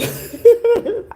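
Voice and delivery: sudden high laugh at herself; high burst of laughter. Real, not acted. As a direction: laugh out loud, high and free. Laughing at own remark.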